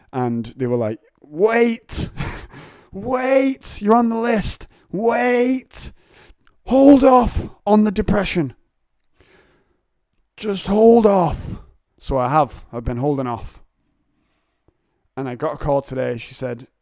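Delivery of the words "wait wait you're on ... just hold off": high, loud put-on receptionist voice; squeaky mock official telling him to wait; high silly voice brushing him off; mocking service voice. Mocking counselling service on phone.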